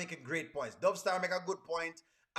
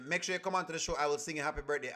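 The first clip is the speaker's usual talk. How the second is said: normal talking voice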